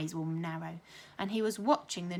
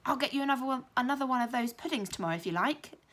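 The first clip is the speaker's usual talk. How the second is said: exclaimed; 5 semitones higher, 4 dB louder, faster than usual. A narrator's reading. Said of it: "exclaimed Josie".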